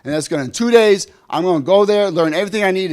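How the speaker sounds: put-on young doctor reading the fax ad, bursting with excitement; thrilled. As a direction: cheer like your prayers were just answered. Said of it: "I said".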